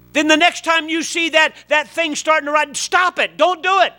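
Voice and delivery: loud, high preaching; impassioned preaching. Real, not acted. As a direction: preach it with rising fire. Preaching builds again.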